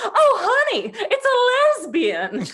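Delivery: high, loud campy quip through laughter; imitating Brian. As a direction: camp it up like a gossiping friend. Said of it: "Brian turns to me and goes".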